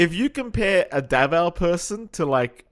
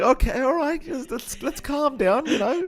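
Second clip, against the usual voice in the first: nervous backing off; 11 semitones higher than usual. Retold or imitated. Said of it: "I'm like".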